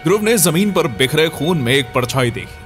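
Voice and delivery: ordinary talk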